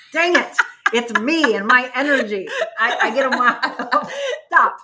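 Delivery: talking through real laughter, high and loud; laughing. Real, not acted. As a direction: giggle helplessly at the puppy. Laughing at the squirming puppy.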